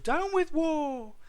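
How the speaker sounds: very high, drawn-out hoot; small duckbill's piping protest. A narrator's reading. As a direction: hoot slowly, far up in pitch. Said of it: "he hooted".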